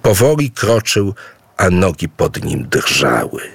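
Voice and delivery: plain reading voice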